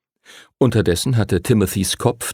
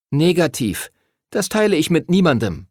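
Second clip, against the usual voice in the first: put-on higher, eager know-it-all boy voice; nerdy boy Arthur; 8 semitones higher than usual. A narrator's reading. Arthur the nerd introduces himself to Henry, high and eager. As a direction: lay out the numbers like a little know-it-all.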